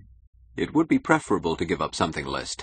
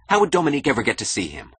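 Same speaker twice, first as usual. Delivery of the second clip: fast, high and loud challenge; angry snap; 8 semitones higher, 5 dB louder, faster than usual. A narrator's reading. Keating doubts Dominique can see Wynand, snapping angrily. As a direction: fire the question off irritably. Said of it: "snapped Keating angrily".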